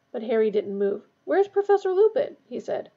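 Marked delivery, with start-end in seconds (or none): 1.2-2.4 high, loud, anxious demand; insistent questioning. A narrator's reading. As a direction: demand an answer, pitch raised. Harry confronts unexpected substitute teacher.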